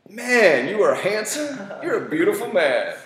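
loud, high, hammed-up self-praise; movie-style self-admiration. Man admiring himself in the mirror, hammed-up. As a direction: hype yourself up like a movie hero. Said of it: "do like they do in the movies".

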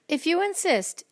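high, sweet, sing-song reply. A narrator's reading. Friendly ladybug accepting a fight, high and sweet. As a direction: answer sweetly, pitched well up. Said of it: "answered the friendly ladybug sweetly".

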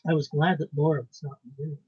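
ordinary delivery, nothing marked

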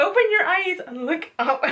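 high, giggling instruction; laughing. Real, not acted. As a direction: giggle through it. Laughing while doing makeup.

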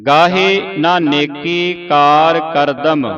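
slow, measured chant of the line; slow recitation. Shown as a demonstration. Teacher reciting the verse slowly for pupils. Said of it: "ਕਰਦਮ ਤੇ ਵਿਸਰਾਮ ਲਾਉਣਾ ਹੈ".

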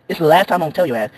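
usual speaking voice

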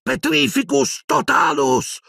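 high, slow incantation; yelled spell. A narrator's reading. Boy fighting off the dead hand, yelled spell. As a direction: cry the curse out forcefully. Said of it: "urlò Harry".

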